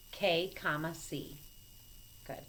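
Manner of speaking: ordinary delivery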